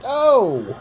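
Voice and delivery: high, loud sudden oh; surprised shout. Real, not acted. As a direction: gasp out loud. Reacting to a slap.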